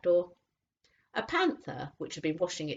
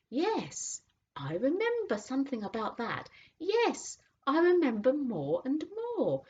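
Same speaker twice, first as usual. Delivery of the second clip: thoughtful horse; 10 semitones higher than usual. A narrator's reading. Horse remembering the cab, thoughtful.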